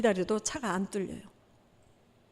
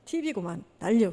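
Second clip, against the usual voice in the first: ordinary delivery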